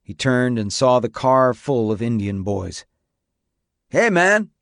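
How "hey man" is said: high, raised call from a car; yell. A narrator's reading. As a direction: yell it out to catch his attention. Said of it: "one of them yelled".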